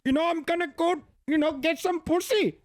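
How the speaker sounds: high-pitched imitation Indian accent. Streamer imitating an actor's movie role, mock Indian accent. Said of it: "He had an Indian accent".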